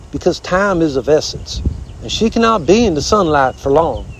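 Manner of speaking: blustery southern armadillo; folksy southern critter voice; bossy armadillo. Acted. Armadillo speaks at length.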